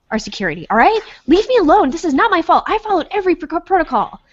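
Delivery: high-pitched defensive fluster; freaking out. Acted. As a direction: plead innocence in a rising panic. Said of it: "freaking out about, like".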